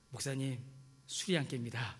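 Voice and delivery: put-on low, soft, slurred drunk mumble; drunk young man. Pastor voicing a drunk young man, low and soft. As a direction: mumble like a hungover youth.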